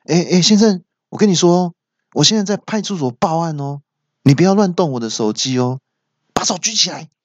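high-pitched, acted nervous warning; nervous woman. Phone owner warning the stranger who has it, acted nervous. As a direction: imitate a tense woman caller, voice up high. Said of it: "就用很紧张的语气说".

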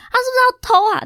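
音调拔得很高的质问; 不敢相信地高声质问; 气愤怀疑. Retold or imitated. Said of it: "然后我就想说很气". (怀疑司机偷iPhone，高声气愤地问)

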